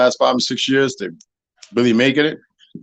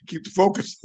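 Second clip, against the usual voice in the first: ordinary talk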